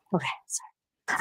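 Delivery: winded, panting between words; gasping for air after a walk; out of breath. Real, not acted. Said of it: "I'm out of breath".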